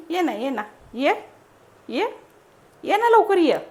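high, loud sing-song coaxing. Real, not acted. Mother coaxes baby again.

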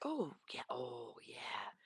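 soft, breathless oh yeah; whispered delight. Retold or imitated. Shopper flipping coupons, whispering to herself. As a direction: murmur it to yourself, pleased.

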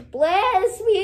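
hammed-up cry for blessing; mock wailing plea. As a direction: wail it out, stretching the last word. Same plea repeated, hammed up.

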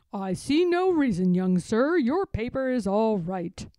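a firm constable laying down the law; gruff policeman. Acted. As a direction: policeman telling the pig he may walk along. Policeman character speaks again.

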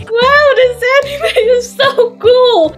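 very high, excited squeal; high-pitched cartoonish awe; gushing anime fan. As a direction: squeal it like a thrilled little character. Liam excited watching anime.

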